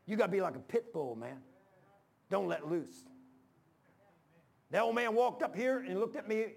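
ordinary talk, nothing marked

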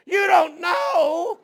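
slow, high, loud incredulous yell; panicked shout. Retold or imitated. Preacher yelling at the pilot about the manual, high panicked shout. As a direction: scream it in laughing panic.

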